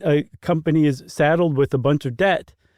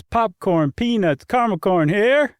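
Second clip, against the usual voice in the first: stadium vendor hawking; 6 semitones higher than usual. Retold or imitated. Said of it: "The one I always remember is".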